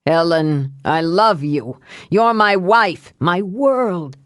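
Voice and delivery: pleading husband, drawn out toward the end; put-on voice of a beseeching husband; Evan. A narrator's reading. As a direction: implore her with declarations of love. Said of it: "he said in Evan's voice".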